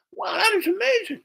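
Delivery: high falsetto wonder; mock awe in a squeaky voice; amazed girl. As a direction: imitate a girl marvelling at food. Girl tastes grilled cheese.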